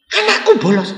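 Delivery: high, startled disbelief; shocked parent. Retold or imitated. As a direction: gasp it out like a stunned father. (ayah kaget dengan suara tinggi)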